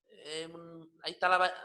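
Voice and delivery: ordinary delivery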